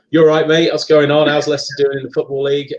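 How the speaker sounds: matey Leicester chat with the cabbie; Leicester accent. Retold or imitated. Landing home and chatting to the taxi driver in his Leicester accent. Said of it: "my Leicester accent comes back out".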